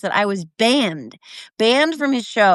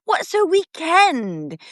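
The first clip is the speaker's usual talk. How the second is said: slow, lilting posh British lady; a light British dowager accent; Maggie Smith impression; 6 semitones higher, much slower than usual. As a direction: drawl it like a dowager countess. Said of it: "That's a good accent".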